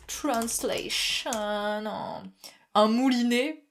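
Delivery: very slow, stretched mock announcement; teasing slow reveal of the translation; drawn out. Drawn-out mock search for word.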